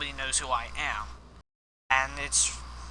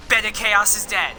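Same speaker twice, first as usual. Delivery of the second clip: loud, raised victory call; real raised-voice declaration of a win; triumphant announcement; 5 semitones higher, 6 dB louder than usual. Real, not acted. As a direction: call out the boss kill, higher and louder. Announces the boss just beaten.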